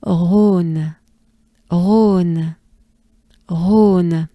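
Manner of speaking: Rhône with airy throat R and long loud closed O; Rhône repeated as "hrohn", n released; breathed French R. Shown as a demonstration. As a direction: push Rhône out on a good breath, swelling on the O. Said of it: "we take a good breath first".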